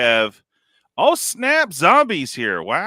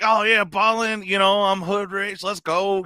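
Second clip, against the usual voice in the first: much higher, swaggering mock boast; 10 semitones higher than usual. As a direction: show off like you're balling. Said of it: "You're like".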